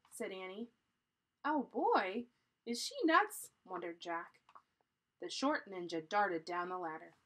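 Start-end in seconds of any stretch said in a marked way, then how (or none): 1.4-3.5 loud, high, exasperated wonder; incredulous. A narrator's reading. Jack wonders if his sister is crazy, incredulous. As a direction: react as if she's crazy. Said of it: "Jack's thinking she's a little crazy".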